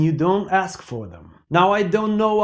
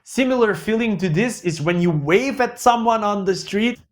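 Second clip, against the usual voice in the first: normal talking voice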